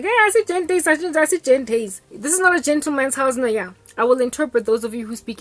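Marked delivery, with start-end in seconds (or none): 0.0-3.7 high voice of the tipsy mother; mother muses about a gentleman's house, high-pitched; mom in Sesotho. Retold or imitated. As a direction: chirp it high, half scolding. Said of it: "first she's like".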